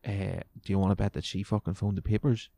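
usual speaking voice

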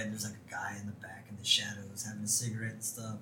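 hushed, secretive whisper. Real, not acted. Describing shadowy smoking man.

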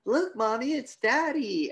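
put-on high little-girl voice calling to mom. Girl spots her dad and calls to mom, high mock child voice. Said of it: "The girl turns around and goes".

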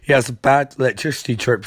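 ordinary delivery

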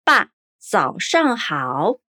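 爸，早上好 said slowly with clear tones; slow Mandarin. Shown as a demonstration. Teacher models dad, good morning slowly for repetition. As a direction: give 爸，早上好 word by word. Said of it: "Mais uma vez".

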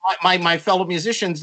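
ordinary talk

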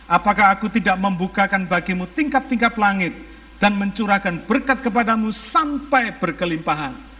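ordinary talk

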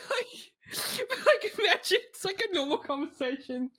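high voice broken by giggles; laughing through words. Real, not acted. Host explaining the joke, giggling high. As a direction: talk while cracking up.

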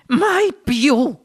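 high, spent exclamation; gasping. A narrator's reading. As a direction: pant it out after a frightening ride. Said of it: "ansimò la signora T V".

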